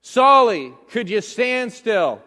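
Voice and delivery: put-on loud call to a wriggling toddler, easing off; parent calling child. Parent calling toddler Solly to hold still. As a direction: call out to the child, then coax. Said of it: "me and my children".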